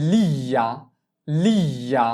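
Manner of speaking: লিয়া said slow and clear, held l; gli syllable drill. Shown as a demonstration. Teacher drills লিয়া for beginners, slow and clear. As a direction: model লিয়া for repetition. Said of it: "তুমি এটার উচ্চারণটা করবা".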